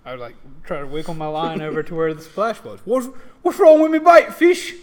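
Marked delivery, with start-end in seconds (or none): 2.9-4.7 high, loud Cockney British orc voice. Acted. Orc coaxes a fish in loud Cockney. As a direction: coax the fish in a chirpy Cockney accent. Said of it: "orcs in this world have a very Cockney British accent".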